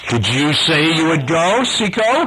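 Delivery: high, rising menacing musing. A narrator's reading. Hook leaning on Seco to go, musing. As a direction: let the question climb as if pondering. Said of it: "he said musingly".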